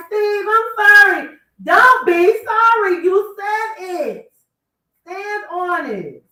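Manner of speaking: high, slow whiny voice; mock pleading apology. Mocking Shirley pleading to Steve, high and drawn-out.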